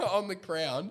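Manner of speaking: ordinary delivery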